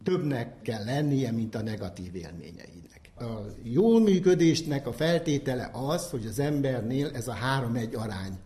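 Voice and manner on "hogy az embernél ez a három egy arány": usual speaking voice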